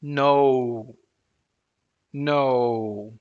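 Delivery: no drawn out slowly to show the glide. Shown as a demonstration. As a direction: stretch no so the glide is heard. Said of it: "Let's say it slowly".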